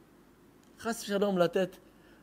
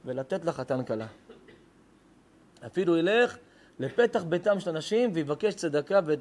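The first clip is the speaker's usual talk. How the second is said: fervent preaching; 2 semitones higher than usual. Acted. Rabbi pressing the congregation on the mitzvah, fervent preaching. Rabbi rules on collecting for wedding.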